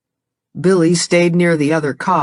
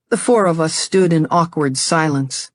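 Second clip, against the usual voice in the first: plain reading voice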